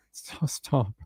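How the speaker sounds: soft whispered stop to the dog. Real, not acted. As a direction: hush the dog quietly. Shushing the dog.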